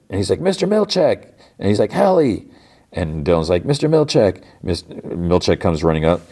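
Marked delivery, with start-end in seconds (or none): 0.4-1.2 high-pitched retold call for help; mock shout, pitched way up. Calling out for the boss. 1.9-2.5 high imitation of calling a missing friend; retold search call. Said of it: "calls for Helly". 3.6-4.4 high retold call to the boss; pitched-up mock holler. Calling out for the boss.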